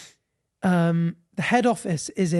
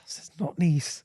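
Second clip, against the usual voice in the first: hushed whisper; 4 dB quieter than usual. Real, not acted. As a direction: say it under your breath. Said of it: "whisper heard".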